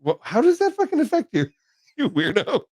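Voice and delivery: high, loud mock outrage, half laughing; mock indignation. Teasing his bandmate who sulks, mock outraged and high. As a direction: playfully pretend to be offended.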